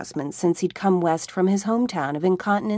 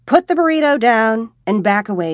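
louder, commanding mock order; mock warning; 3 semitones higher, 8 dB louder than usual. A narrator's reading. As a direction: order him off the burrito like a cop. Jody warns Tommy half-joking.

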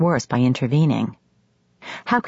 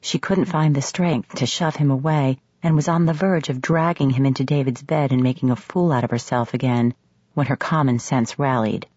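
usual speaking voice

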